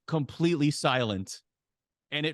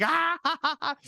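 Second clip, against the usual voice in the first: mock crowd laughter; 14 semitones higher than usual. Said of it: "they just start laughing".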